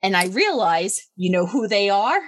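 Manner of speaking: ordinary talk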